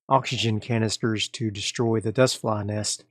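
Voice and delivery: ordinary talk, nothing marked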